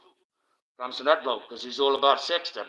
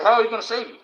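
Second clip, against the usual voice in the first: higher, louder mocking whine; mock plea; 8 semitones higher, 5 dB louder, faster than usual. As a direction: mimic someone begging to be saved. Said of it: "always gonna say".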